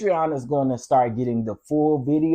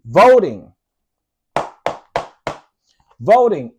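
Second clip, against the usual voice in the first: emphatic call; 5 semitones higher, 9 dB louder, slower than usual. Real, not acted. Calling out to the audience.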